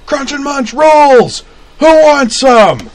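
very high, slow bellow; bellowing vendor. A narrator's reading. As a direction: bellow it like a hawker. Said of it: "and bellowed".

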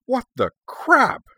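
high, loud, drawn-out yelp of confusion; startled outburst. Acted. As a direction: burst out in bewildered indignation. Dragon startled by sudden teleport.

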